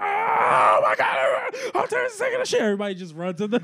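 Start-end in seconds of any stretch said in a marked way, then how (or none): 0.0-2.6 high, loud, slow panicked cry; panicked yell. Retold or imitated. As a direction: shout it from the stall. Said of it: "all you hear is this".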